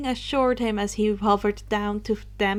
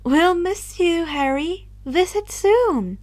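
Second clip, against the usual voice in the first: fond farewell; 6 semitones higher, 4 dB louder, slower than usual. Acted. Athena saying goodbye, higher and slow.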